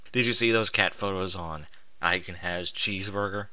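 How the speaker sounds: ordinary talk, nothing marked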